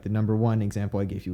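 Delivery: ordinary talk, nothing marked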